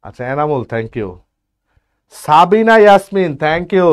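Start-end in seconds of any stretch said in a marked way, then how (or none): 2.2-3.9 loud, drawn-out name call. Real, not acted. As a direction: call the name across the class. Teacher calls out student's name.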